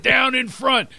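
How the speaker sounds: high-pitched Philadelphia heckler call; horrible Philadelphia accent. Retold or imitated. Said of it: "with that horrible accent".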